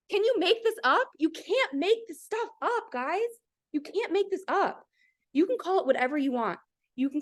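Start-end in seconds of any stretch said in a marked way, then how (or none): 0.1-4.8 amazed disbelief. Real, not acted. Amazed by the sign's message.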